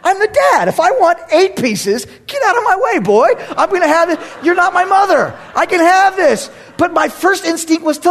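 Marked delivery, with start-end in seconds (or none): 0.0-6.5 indignant dad. Retold or imitated. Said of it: "I'm like".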